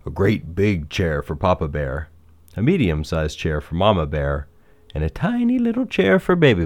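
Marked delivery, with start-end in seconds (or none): 5.1-6.6 high, squeezed-up words for something tiny; small, high storybook emphasis; tiny-little voice. A narrator's reading. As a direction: lift way up for the small thing.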